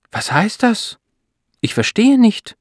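high-pitched, disbelieving questions; can't believe your ears, voice shooting up; astonished. A narrator's reading. Wife to doctor on puzzling test result, astonished. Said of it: "fragt Beate erstaunt".

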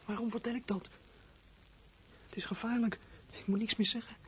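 very soft, afraid to be heard; scared whisper. Acted. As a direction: hush it to himself. Boy fears telling, drops voice. (angstig, heel zacht gefluisterd)

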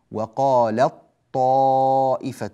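"وقالت طائفة" with the ta swallowed into a heavy doubled "طـ"; slow Quranic chant of "وقالت طائفة"; recited assimilation. Shown as a demonstration. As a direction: draw out "وقالت طائفة" slowly, tajweed style. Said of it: "لاحظوا أننا أدغمنا".

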